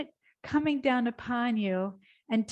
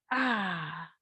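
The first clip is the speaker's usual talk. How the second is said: usual speaking voice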